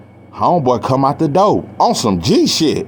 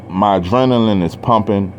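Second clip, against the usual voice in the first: ordinary talk, nothing marked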